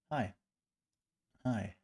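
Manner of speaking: ordinary talk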